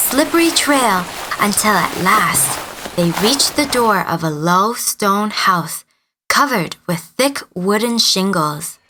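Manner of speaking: ordinary delivery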